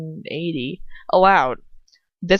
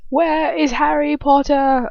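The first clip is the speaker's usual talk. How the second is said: high, slow put-on English accent; British accent; 7 semitones higher, 4 dB louder, slower than usual. Trying out Bellatrix's voice, British accent. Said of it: "British accent".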